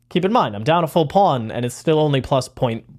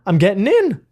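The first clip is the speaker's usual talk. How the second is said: excited inner voice; 9 semitones higher, 3 dB louder than usual. Retold or imitated. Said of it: "I'm like".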